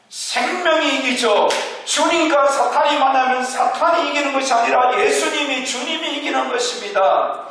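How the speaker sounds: fervent sermon climax, climbing in pitch; rising preaching. Acted. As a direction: drive the congregation to the answer.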